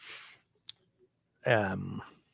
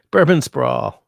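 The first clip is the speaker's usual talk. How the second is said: louder, delighted read-out; plain; 3 semitones higher, 9 dB louder, slower than usual. Retold or imitated. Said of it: "that's a quotable quote".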